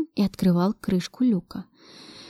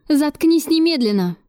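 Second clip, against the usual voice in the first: stern command; 6 semitones higher, slower than usual. A narrator's reading. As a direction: shut him up firmly. Heroine silences the wailing prisoner. (строгий окрик)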